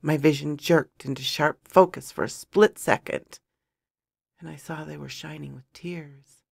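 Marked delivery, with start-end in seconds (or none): none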